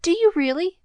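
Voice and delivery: put-on a girl pleading with the newsboy, eagerly; beseeching. A narrator's reading. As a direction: beg him to be sure, hopeful. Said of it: "besought Pollyanna".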